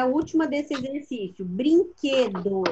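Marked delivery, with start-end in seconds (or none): 1.4-2.6 "Brinquedo" slowly sounded out by syllables; syllable demonstration. Shown as a demonstration. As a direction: stretch "Brinquedo" so the stressed "que" stands out. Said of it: "Qual é a sílaba".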